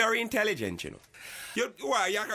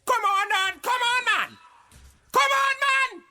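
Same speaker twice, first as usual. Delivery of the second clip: high, loud, building cry; exasperated shout; 12 semitones higher, 10 dB louder than usual. Acted. Host trying to stop the on-air fight, shouting higher. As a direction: plead loudly, rising each time.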